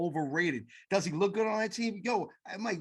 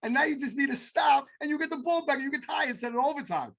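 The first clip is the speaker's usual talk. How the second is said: heated rant; 9 semitones higher than usual. Real, not acted. Angry rant at quarterback.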